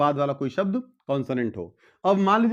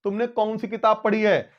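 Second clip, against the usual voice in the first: normal talking voice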